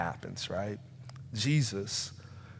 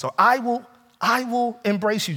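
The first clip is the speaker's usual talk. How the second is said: raised, higher voice making a loud promise; loud bargaining plea; 11 semitones higher, 5 dB louder than usual. Acted. Preacher voicing people who bargain with Jesus, loud. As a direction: belt the bargain out high and loud.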